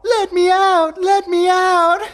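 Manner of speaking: high shrill mock pleading, slow; imitating a trapped girl's loud pleas. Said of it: "Jack imitated her voice".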